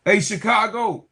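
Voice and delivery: ordinary delivery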